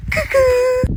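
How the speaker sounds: very high sing-song greeting; spring a sugary surprise; creepy cheery hello. Acted. Teacher to pupil on holiday, very high sing-song hello.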